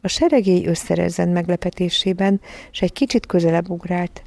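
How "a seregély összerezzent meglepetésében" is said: ordinary delivery, nothing marked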